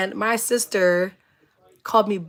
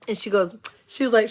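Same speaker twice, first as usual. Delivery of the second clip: ordinary delivery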